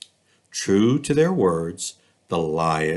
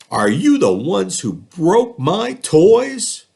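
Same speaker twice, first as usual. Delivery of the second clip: put-on stern boy questioning; 12 semitones higher, 7 dB louder, slower than usual. Walter confronts the animals.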